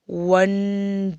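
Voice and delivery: "वंडरफुल" stretched slowly into three beats, वं-डर-फुल; syllable by syllable. Shown as a demonstration. Teacher counting three syllables for pupils, "वंडरफुल" slowly broken up. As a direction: draw out each syllable of "वंडरफुल". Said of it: "ये जो है तीन syllable का है".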